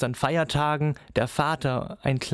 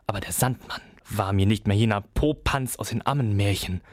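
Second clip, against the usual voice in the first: ordinary delivery, nothing marked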